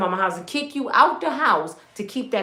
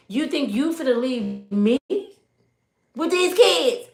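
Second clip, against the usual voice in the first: put-on sassy old wife; 5 semitones higher than usual. Voicing an angry old wife.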